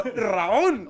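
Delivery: put-on Raúl called in a loud Russian accent. Imitating the Russian guy calling him in a loud Russian accent. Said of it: "Tenía, tenía acento ruso".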